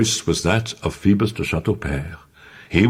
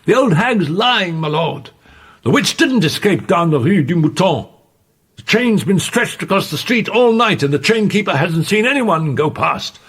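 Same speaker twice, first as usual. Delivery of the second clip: loud, high soldier's report; calling out; 4 dB louder than usual. A narrator's reading. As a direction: shout it up to the lord.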